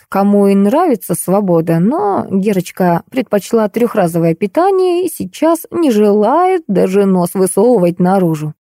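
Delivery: usual speaking voice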